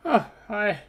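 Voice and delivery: gushing Delg. Acted. Delg bursting in to thank Melios, very high and gushing. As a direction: swoon over your rescuer.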